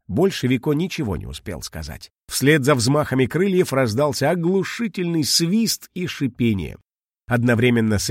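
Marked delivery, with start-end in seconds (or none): none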